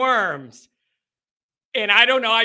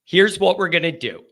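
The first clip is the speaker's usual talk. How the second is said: normal talking voice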